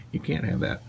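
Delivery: unvoiced whisper to the dog. Real, not acted. Telling dog no.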